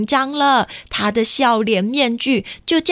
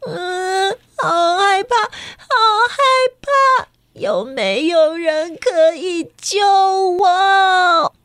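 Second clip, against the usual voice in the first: high, slow, tearful fright; a little girl about to cry for help; scared child whimpering; near tears; 6 semitones higher, slower than usual. Acted. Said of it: "苏丽都快哭出来了".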